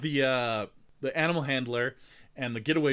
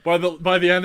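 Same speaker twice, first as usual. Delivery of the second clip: ordinary delivery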